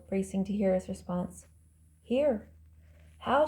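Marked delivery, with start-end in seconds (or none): 2.0-2.5 higher, restrained answering call; calling back. A narrator's reading. Answering her yells from afar. 3.2-3.5 mild narrated yell. A narrator's reading. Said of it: "she yelled again".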